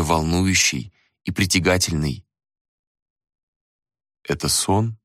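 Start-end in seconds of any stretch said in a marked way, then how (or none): 4.2-5.0 hoarse voice. Acted. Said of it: "прозвучал севший голос".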